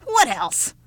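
irritable, loud retort; snapped. Acted. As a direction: snap it as if obvious. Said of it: "snapped the old woman".

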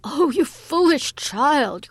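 mother crying out, slow and high; loud, drawn-out alarm at a child's folly. A narrator's reading. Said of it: "cried her mother in alarm".

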